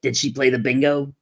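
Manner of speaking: grandmother voice. Pretend.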